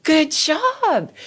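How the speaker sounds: high, bright praise; praising. Retold or imitated. Mom praising her son for naming anger, high praise. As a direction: cheer the kid on, high. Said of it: "And I'm like".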